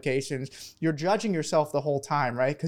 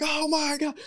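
much higher, shrieking with joy; ecstatic scream; 12 semitones higher, much slower than usual. Retold or imitated. Hearing he got into Jefferson, a high joyful shriek. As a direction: squeal out the good news like you can't believe it. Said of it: "I screamed".

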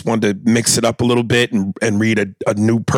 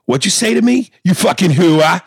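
higher, louder confrontational outburst; heated, raised-voice mobster comeback; Christopher; 6 semitones higher, 4 dB louder than usual. Acted. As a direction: fire it back as an affronted challenge. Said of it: "Christopher says".

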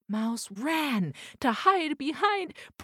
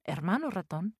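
ordinary delivery

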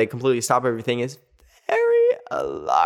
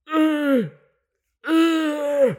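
mock squeak. Stunned pause after the dreaded topic.

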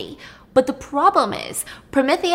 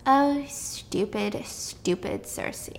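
hushed, slow, pitying scorn; soft disdain; 7 dB quieter, much slower than usual. Acted. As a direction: let it sink slowly almost to a whisper. Dismissive verdict on Circe, acted.

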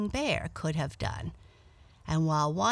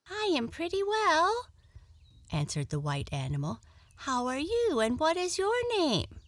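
much higher, chirpy animal voice; friendly little rabbit; 10 semitones higher than usual. Acted. Rabbit character's voice.

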